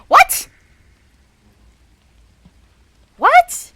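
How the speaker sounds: sudden high disbelieving yelp; real shock, voice jumping up high and loud; stunned double take; shocked what. Real, not acted. Shocked at unseen vampire dialogue.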